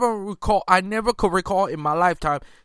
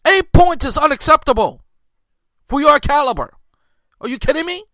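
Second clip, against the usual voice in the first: heated scolding; 6 semitones higher, 4 dB louder than usual. Real, not acted. Scolding the star player.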